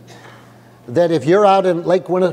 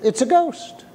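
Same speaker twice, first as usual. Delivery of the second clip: mock curious; 8 semitones higher than usual. Pastor showing how the disciples did not speak, curious and unafraid. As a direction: say it like a casual observation, no alarm. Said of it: "in curiosity".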